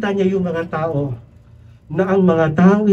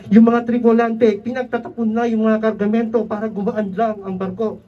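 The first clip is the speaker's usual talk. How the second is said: normal talking voice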